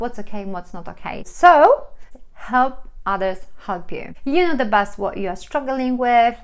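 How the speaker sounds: ordinary talk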